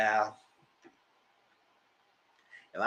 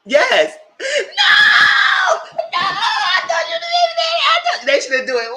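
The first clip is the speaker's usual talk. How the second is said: high, loud near-screaming outburst; screaming excitedly at the TV; excited shouting; 11 dB louder, slower than usual. Real, not acted. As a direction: shout it out, overexcited. Excited shouting reaction to show.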